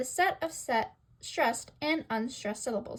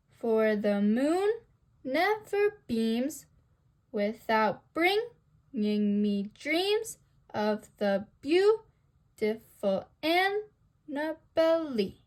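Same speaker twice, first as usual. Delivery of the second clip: "For the moon never beams without bringing me dreams of the beautiful Annabelle" slowly, in da-da-DUM feet; anapestic scansion; slower than usual. Shown as a demonstration. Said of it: "unstressed, unstressed, stressed".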